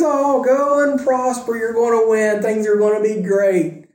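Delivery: oversell the good news so nobody believes it; sarcastic. Retold or imitated. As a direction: prophet sarcastically promising victory to Ahab. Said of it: "this is absolutely dripping with sarcasm".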